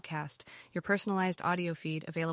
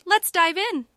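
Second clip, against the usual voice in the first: much higher and louder, bursting with energy; excited kickoff; 11 semitones higher, 10 dB louder, slower than usual. Real, not acted. Podcast host hyping listeners into the article, excited. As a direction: launch it like a cheer to start the show.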